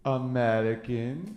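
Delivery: put-on lingering French-accented delivery; mock French accent. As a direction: imitate a Frenchman drawing out his punchline. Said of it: "this one French guy".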